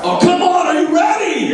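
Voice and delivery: hype shout. Real, not acted. Author firing up fourth graders, shouting high. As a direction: pump the crowd up. Hyping up the kids.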